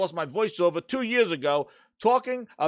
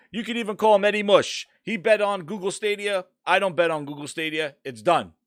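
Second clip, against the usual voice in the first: ordinary talk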